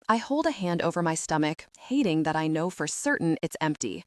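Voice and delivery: usual speaking voice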